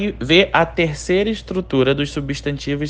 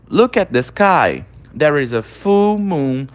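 slow model reading; slower than usual. Shown as a demonstration. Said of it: "Olhe para o céu".

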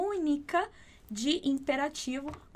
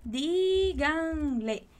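"díganle" very slow, stress on DÍ; showing the accent on DÍ; slow stress demo; 2 semitones higher, much slower than usual. Shown as a demonstration. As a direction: stretch "díganle", leaning on the first syllable. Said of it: "a ênfase dela tá na primeira sílaba".

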